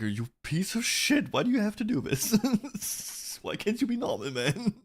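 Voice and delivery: high, slow, exasperated mock rage; half-laughing mock fury; mock angry rant. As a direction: pretend to lose it at a friend in disbelief. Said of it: "I would get angry. I would be like".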